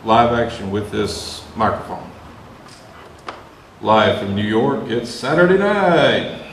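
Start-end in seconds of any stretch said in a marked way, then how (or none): 3.8-6.4 slow, big show-opening announcer call. Pretend. Mimics SNL opening announcement.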